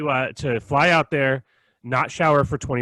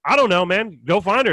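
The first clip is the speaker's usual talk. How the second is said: louder, offhand brush-off; dismissive retort; 6 semitones higher, 5 dB louder than usual. Retold or imitated. To pushy customer, a louder dismissive brush-off. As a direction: shrug him off.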